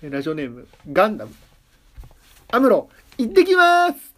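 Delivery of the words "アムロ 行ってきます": high, loud anime hero cry; Amuro launch call. Acted. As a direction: shout it like a pilot launching. Gundam line read as listener name.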